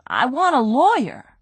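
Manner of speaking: high whiny demand for a lawyer; whining. Acted. Crook whines at the detective for a lawyer, high and whining. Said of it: "Brady whined".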